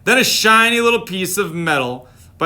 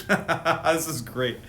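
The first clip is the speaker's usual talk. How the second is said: ordinary delivery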